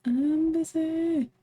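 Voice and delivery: high, slow, drawn-out busy; silly put-on voice. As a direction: stretch busy out in a high mock voice. Repeats the brush-off in a silly voice.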